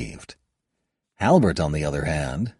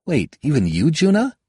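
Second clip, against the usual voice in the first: surprised; 9 semitones higher, 4 dB louder than usual. Acted. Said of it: "I asked, surprised".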